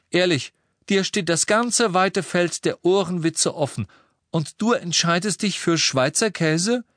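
high, incredulous teasing; mock disgust. A narrator's reading. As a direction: scoff at a feeble joke. Twin scoffs at weak joke.

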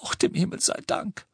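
high, airy, tearful thanks; tearful, whispery high voice; sobbing relief. A narrator's reading. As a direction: sob it out in relief. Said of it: "schluchzte Misses Weasley".

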